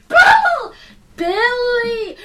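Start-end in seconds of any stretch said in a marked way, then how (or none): 0.1-0.7 high shouted correction; shouting. Acted. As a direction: yell the name back. Arguing over the name.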